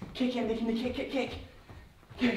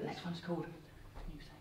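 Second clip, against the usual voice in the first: whispering; 4 semitones lower, 22 dB quieter than usual. Real, not acted. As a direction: whisper it softly. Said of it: "whispering cue to child".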